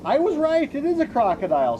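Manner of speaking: high and loud with alarm; high, quick alarmed cry; excited. Acted. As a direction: blurt it out, startled and sure. Discovering the crocodile.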